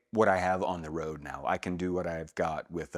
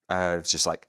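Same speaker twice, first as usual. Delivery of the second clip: usual speaking voice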